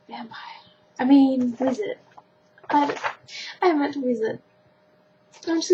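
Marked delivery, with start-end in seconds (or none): 0.1-0.6 whispering. Real, not acted. Whispered wrong answer.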